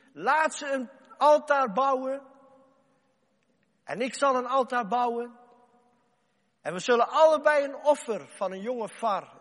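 loud, high challenge to the people; prophet's loud ringing challenge; Elia proclaiming. Acted. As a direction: call out the contest boldly. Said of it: "Elia zei".

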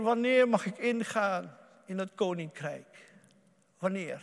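ordinary talk

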